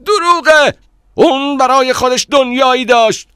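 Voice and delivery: very high, very loud outcry; shouting. A narrator's reading. Man calling the narrator a liar, shouting. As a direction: scream the contradiction at full volume. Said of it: "فریاد زد".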